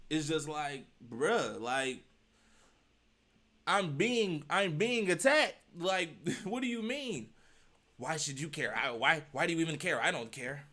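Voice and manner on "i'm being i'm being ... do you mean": higher, louder mock whine; mock victim. Mocking the man who claims he is attacked, higher and louder. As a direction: play up feeling attacked.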